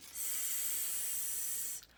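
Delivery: long, soft steady hiss; snake hiss. Acted. Unseen snake in the forest.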